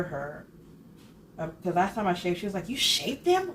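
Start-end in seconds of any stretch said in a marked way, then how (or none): 2.7-3.5 high, louder indignant question, imitating the eyebrow lady; shocked scolding, hammed up; mock angry outrage. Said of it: "she was so mad".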